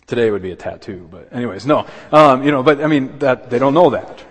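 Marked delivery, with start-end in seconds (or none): 2.1-4.2 much louder, higher pleading push; loud preacher's emphasis; emphatic preaching. Real, not acted. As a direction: drive it home to the congregation. Preacher pressing the point.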